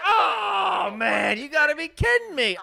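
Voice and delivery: very high shouted whoop, easing down; overjoyed disbelief. Acted. Prank caller bursting in on telemarketer's pitch, hammed-up elation. Overplayed joy interrupting the telemarketer.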